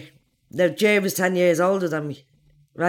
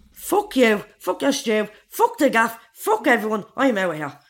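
raised, angry storming-out lines; retold angry outburst; 5 semitones higher than usual. Teenager telling her mother off as she storms out, angry. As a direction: spit it at your mother like a teenager leaving. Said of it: "And I says".

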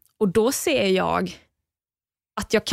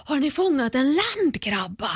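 Micke's amazed question; 6 semitones higher, slower than usual. Retold or imitated. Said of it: "Och Micke bara".

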